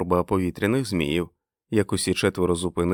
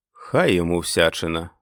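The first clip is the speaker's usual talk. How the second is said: plain reading voice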